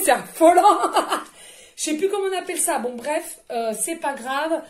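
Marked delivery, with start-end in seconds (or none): 0.0-1.8 rire excité. Real, not acted. Synchronicité, rire d'excitation.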